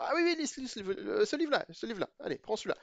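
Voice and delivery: high-pitched mock insistence; mock eager recommender. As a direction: parody the crowd urging a beginner to read it. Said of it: "on va vous dire".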